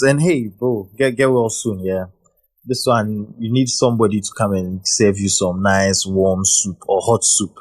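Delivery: ordinary talk, nothing marked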